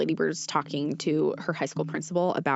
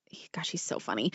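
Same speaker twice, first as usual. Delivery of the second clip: breathless whisper, almost to herself; whispered aside; 3 semitones lower, 8 dB quieter than usual. Real, not acted. Podcast host gushing about Timothée Chalamet's role, whispered. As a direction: lean in and whisper it fondly.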